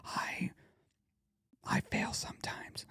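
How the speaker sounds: whispered under the breath; hushed, voiceless self-talk. Shown as a demonstration. Coaching listeners who are around people, whisper. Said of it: "whisper it under your breath".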